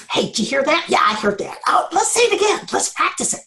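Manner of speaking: playful little voices, jumping in pitch; sneaky chattering neurons. Pretend. Neurons sneaking off to practice during sleep, playfully conspiratorial. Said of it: "they go sneaking off together and they're like".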